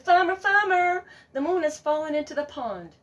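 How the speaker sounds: high, loud alarmed call; urgent goose. Acted. Goose alerting the farmer, urgent and high. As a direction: call out breathless with news.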